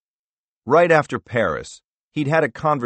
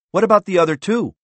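much higher, louder and fast; angry interruption; 11 semitones higher, 7 dB louder, faster than usual. Acted. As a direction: cut him off sharply. Wells interrupts.

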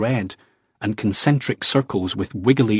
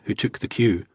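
plain reading voice

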